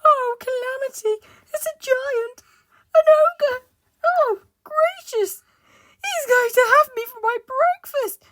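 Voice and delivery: very high, loud, hysterical wailing; terrified scream. Acted. Mister Jelly thinks the tramp's an ogre, panicked scream. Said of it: "he screamed".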